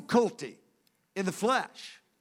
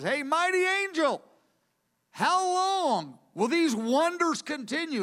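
raised-pitch quoted angel, slow on the question; voicing an angel; 7 semitones higher, slower than usual. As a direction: call across the river as a pleading angel. Angel voiced in retold scripture.

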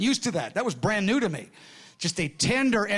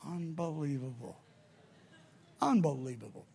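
awed hush; 4 semitones lower, 8 dB quieter than usual. Retold or imitated. Awed at finding the movement.

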